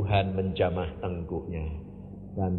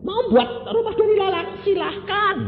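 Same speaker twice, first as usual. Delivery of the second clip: loud, high, fast sermon cry; fiery preaching; 12 semitones higher, 3 dB louder than usual. Acted. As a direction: preach it out at full force. Preacher challenges the congregation. (khotbah berapi-api dengan suara keras)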